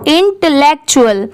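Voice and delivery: इंटेलेक्चुअल said clearly as in-te-lek-chu-al; teacher models इंटेलेक्चुअल syllable by syllable; model pronunciation. Shown as a demonstration. Teacher shows students how to read it, careful model pronunciation. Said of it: "देखिए इसे कैसे पढ़ते हैं".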